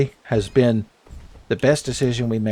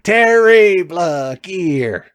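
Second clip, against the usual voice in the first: slow hammed-up Snake line; mock Snake voice, very slow; bad Solid Snake impression; 5 semitones higher, 4 dB louder, much slower than usual. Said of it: "a bad Solid Snake impression".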